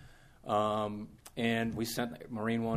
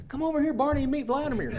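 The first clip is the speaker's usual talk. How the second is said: high, sing-song call to a pet, laughing; calling the dog; 5 dB louder than usual. Retold or imitated. President calls Barney over to meet Vladimir, high and coaxing.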